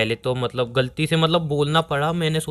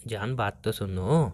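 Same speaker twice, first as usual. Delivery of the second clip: coaxing; 6 semitones lower, 6 dB quieter, slower than usual. Acted. Boyfriend soothes angry girlfriend.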